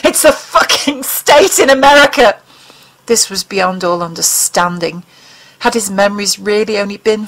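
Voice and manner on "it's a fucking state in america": higher, louder exasperated shout; angry retort. Acted. Mulder snaps at the alien about Montana, higher and loud. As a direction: fling it at him in disbelief.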